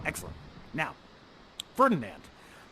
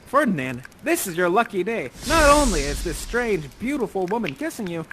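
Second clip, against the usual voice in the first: usual speaking voice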